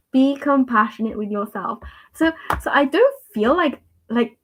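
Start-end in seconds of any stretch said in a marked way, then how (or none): none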